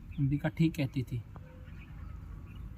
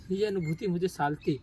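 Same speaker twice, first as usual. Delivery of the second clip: ordinary talk, nothing marked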